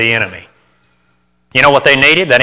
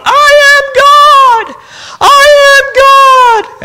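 high, slow, screaming proclamation; mock Shirley MacLaine shout; 6 dB louder, much slower than usual. Preacher mocking MacLaine on a mountaintop, screeching slow shout. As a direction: belt it out like a self-declared deity. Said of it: "standing there on that mountaintop going".

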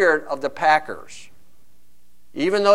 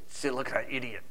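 muttered insult; 8 semitones lower, 18 dB quieter, faster than usual. Acted. Fan muttering about Bears fan.

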